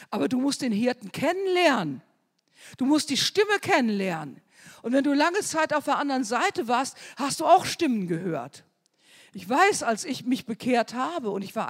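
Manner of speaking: preaching. Real, not acted. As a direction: press the point home to the congregation. Preaching at full force.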